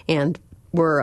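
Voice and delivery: ordinary talk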